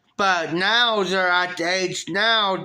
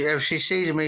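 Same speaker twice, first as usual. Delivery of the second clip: ordinary delivery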